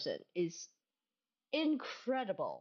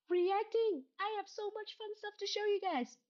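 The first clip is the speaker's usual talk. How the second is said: much higher, gleeful; high gleeful announcement; excited; 11 semitones higher than usual. Real, not acted. Thrilled about reacting.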